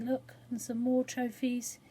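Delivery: ordinary delivery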